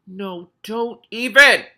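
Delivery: high, slow loud protest. Real, not acted. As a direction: cry out don't even. Reader protesting at the story.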